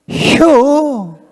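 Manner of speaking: high, stretched Chungcheong one-word reply; Chungcheong dialect. Retold or imitated. Preacher mimicking a Chungcheong person's reply, high Chungcheong drawl. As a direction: answer in a drawn-out Chungcheong dialect. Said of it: "충청도 사람들은요".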